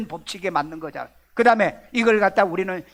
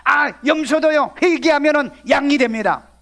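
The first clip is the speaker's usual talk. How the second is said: loud, high imitation of a preacher; mock preacher; 7 semitones higher, 7 dB louder than usual. Preacher quoting misguided pastors, loud mock proclaiming. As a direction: proclaim it loudly, parodying other pastors.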